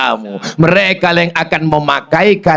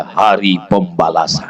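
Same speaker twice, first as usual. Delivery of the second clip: much lower and softer, grave; ominous doom; 10 semitones lower, 3 dB quieter than usual. Retold or imitated. Wife coldly pronouncing judgement over her sick husband, low and grave. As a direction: drop deep into doom.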